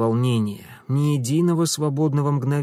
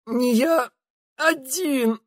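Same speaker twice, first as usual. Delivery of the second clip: wheezing; 12 semitones higher, much slower than usual. Acted. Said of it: "прохрипел тот".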